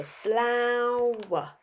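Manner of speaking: teacher modelling "flower" slowly for the class; slow stretched word. Shown as a demonstration. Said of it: "that funny uh sound at the end".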